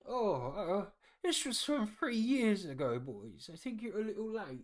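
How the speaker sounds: put-on high, loud old man chuckling at the late boys; a frail old man tickled by the old date; hammed-up old man cackle-talk; old man, amused.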